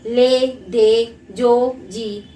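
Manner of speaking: each word drawn out slowly, one by one; slow syllable examples. Shown as a demonstration. Teacher showing students one-syllable words, slow and drawn out. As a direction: spell out the short words slowly for the class. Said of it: "जैसे एक अक्षर वाले शब्द हैं".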